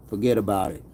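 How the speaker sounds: low movie Italian mobster "Forget about it"; movie Italian accent. Pretend. Streamer after a troll gets whacked, in a low movie Italian accent. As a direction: shrug it off like a movie Italian wiseguy. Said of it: "That's another accent maybe I should work on".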